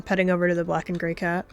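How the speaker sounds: ordinary delivery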